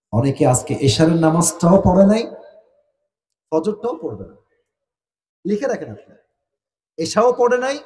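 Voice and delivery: ordinary talk, nothing marked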